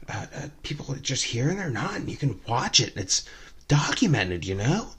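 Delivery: high, hushed and eerily fascinated; creepy drunk woman. Retold or imitated. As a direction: keep her morbid monologue soft and high. Voicing the morbid passenger.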